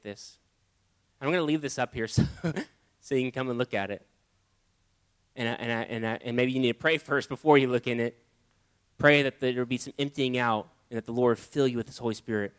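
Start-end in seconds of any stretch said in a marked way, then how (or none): none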